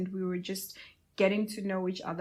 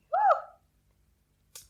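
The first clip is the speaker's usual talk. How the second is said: very high, loud whoop; loud cheer after naming her friend; excited whoop; 11 dB louder than usual. Real, not acted. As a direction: let out a joyful cheer.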